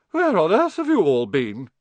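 high, loud, shocked portrait lady; scandalised scolding. Acted. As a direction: demand an explanation, appalled. Portrait scolds late-returning students.

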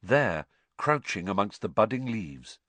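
plain reading voice

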